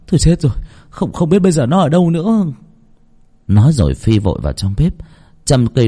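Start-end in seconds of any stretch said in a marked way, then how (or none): 0.1-2.5 high, fast panicked mother; frantic. A narrator's reading. Mother fearing for her lost son, frantic. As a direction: blurt it out in a rush of fear.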